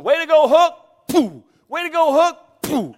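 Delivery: loud, high excited shouting; rowdy teammate's cheer. Retold or imitated. Big linebacker yelling praise at his teammate, rowdy shout. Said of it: "he starts going".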